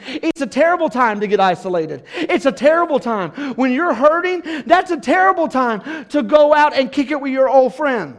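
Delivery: high, loud, urgent sermon; preaching at full force. Acted. Pastor warning the church, loud preaching. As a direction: plead with them, full voice.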